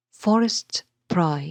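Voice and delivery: slow, loud "Forest pry", with "For" stressed; dactylic stress. Shown as a demonstration. Teacher showing the next dactylic foot, stress on "For". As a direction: hit "For", then two lighter beats in "est pry". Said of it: "one stressed syllable followed by two unstressed".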